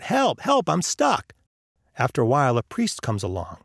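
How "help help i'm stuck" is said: high-pitched mock cry for help; calling out. A narrator's reading. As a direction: call for rescue without really shouting. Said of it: "calling out".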